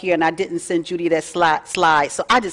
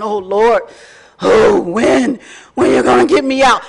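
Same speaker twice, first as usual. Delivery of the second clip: a loud, impatient plea to the Lord, partly acted; mock complaining prayer; 5 dB louder than usual. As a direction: cry out to God, fed up with waiting. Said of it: "I don't just keep saying".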